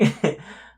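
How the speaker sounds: short unvoiced chuckle at himself; self-amused chuckle; breathy laugh. Real, not acted. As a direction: let out a quick breathy laugh.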